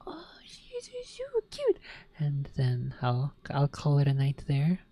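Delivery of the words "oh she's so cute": high, slow squeaky coo; high hushed adoring coo. Real, not acted. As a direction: melt over the cute kitten. Cooing to the cat.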